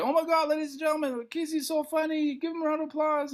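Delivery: high, hyped emcee voice; high MC announcing a comedian; announcer voice. Retold or imitated. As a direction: hype the crowd like a show host. Host J R introducing on stage.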